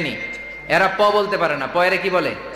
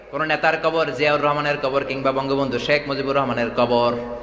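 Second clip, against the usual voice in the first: usual speaking voice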